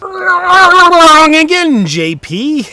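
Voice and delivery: slow, high, loud singsong; mocking game-show voice. Correcting his old self, slow mock announcer voice. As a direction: draw it out like a game-show buzzer verdict.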